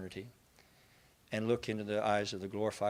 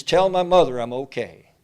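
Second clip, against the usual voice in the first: loud joyful shout across the water; yelled to shore; 3 semitones higher, 13 dB louder than usual. Retold or imitated. John shouts to the shore about his mother, loud and joyful. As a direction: call it back to the shore. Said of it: "John yelled to shore".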